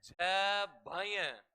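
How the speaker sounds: drawn-out sutra recital; slow recital. Shown as a demonstration. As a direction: stretch झभय slowly. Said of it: "सूत्र है".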